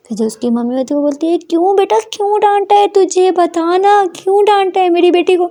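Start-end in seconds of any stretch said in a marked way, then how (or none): 1.4-5.4 high, protective, indignant mother; outraged on her girl's behalf. Acted. Mother demands why her daughter was scolded, high and indignant.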